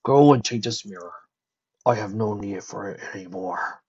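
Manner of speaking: low, quiet, resigned; giving up his last hope; resigned dragon voice. Acted. Spike's final farewell.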